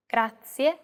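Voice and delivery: Grazie said the Italian way, GRAH-tsee-eh. Shown as a demonstration. Teacher models Grazie for learners, Italian pronunciation. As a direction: say Grazie with a rolled R and a clear final E. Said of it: "This means thank you in Italian".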